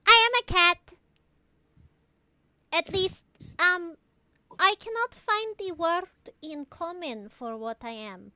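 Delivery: put-on cat-girl voice. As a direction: proudly explain yourself as a cat creature. Storm the cat's character voice.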